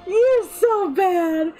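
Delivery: high, slow, loud teasing through laughter; laughing scold. Real, not acted. Streamer teasing a viewer while laughing. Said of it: "you're making me laugh".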